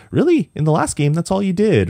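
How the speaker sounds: high-pitched, teasing disbelief; imitated high, skeptical retort; mock-incredulous goddess. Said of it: "And she's like".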